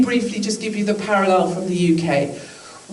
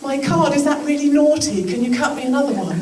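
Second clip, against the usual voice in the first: high, posh Queen-like British plea; exaggerated British; 7 semitones higher than usual. British customer asking for another card, exaggeratedly British. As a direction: turn hammed-up British, pitched way up and apologetic. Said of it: "I go so British you can't even believe".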